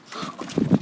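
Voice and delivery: sharp gasp of delight; excited gasp. Real, not acted. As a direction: suck in air at the sight. Spots a ball.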